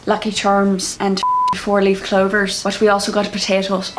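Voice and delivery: joking Irish accent. Shown as a demonstration. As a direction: deliver it as a put-on Irishman. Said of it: "doing an Irish accent".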